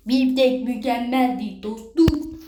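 mock connoisseur praising the steak; hammed-up gourmet voice. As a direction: savour it like a pompous food critic.

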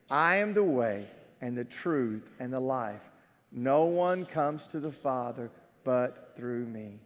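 low, soft reading of scripture; solemn scripture reading. A narrator's reading. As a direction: read Jesus's words quietly and solemnly. Reads Jesus's answer solemnly.

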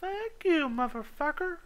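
very high squeaky sarcastic thanks; squeaky mock thanks. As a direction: chirp it in a silly voice.

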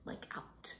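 breathy whispered aside. Real, not acted. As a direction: drop to a bare whisper. Whispered aside about being worn out.

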